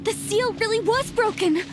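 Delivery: high, excited exclamation; amazed the seal finally gave way; excited amazement. Acted.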